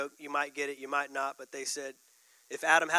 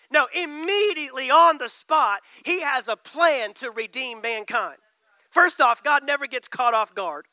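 louder and higher, driving the point home; full-force preaching; 5 semitones higher, 6 dB louder than usual. Acted. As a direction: preach it hard to the congregation.

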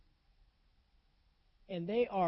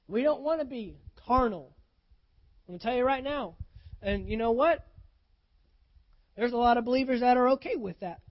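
preaching; 5 dB louder than usual. Real, not acted. Preaching at full force.

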